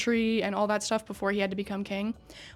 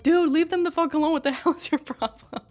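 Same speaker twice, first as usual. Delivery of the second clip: higher, louder mock yell at a pushy friend; retold yell; 6 semitones higher, 4 dB louder than usual. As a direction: yell at him to back off, half laughing. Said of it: "everyone's yelling at Masumi".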